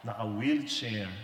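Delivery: ordinary talk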